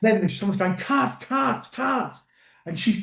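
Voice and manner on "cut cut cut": high-pitched imitation of a crew call; mock calling out. Actor retelling a crew member halting the scene, mock calling out.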